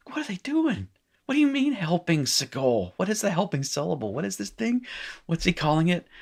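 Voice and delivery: high-pitched baffled questioning; hammed-up, high-voiced confusion; mock bewilderment. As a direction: fire off questions as a confused student. Acts out a baffled student.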